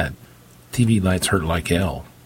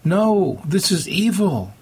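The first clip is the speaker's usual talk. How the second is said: high, drawn-out cry of protest; shouted protest; 12 semitones higher, slower than usual. A narrator's reading. Daughter protests the preacher's ritual, shouting. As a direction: cry out in horror, pitched way up. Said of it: "Meg shouted".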